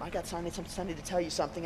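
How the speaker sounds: fast, tumbling words; wife blurting her news fast; rushed nervous blurt. Retold or imitated. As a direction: rush it out nervously. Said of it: "and she goes".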